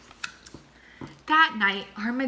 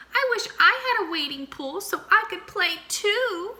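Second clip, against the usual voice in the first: Mouse voice; 10 semitones higher than usual. Acted. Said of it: "he said quietly".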